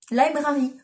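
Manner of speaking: mock English accent on Library, said "laibrari"; English accent, lightly done. Shown as a demonstration. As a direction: try a posh English Library. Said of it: "magnifique accent anglais".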